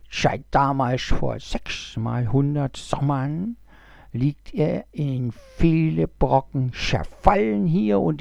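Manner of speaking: high, slow snake voice telling an ancient tale; slow and high, a snake spinning a long-ago story; old snake storyteller. Acted. The snake tells the Abgucker about the fallen tree, slow and high.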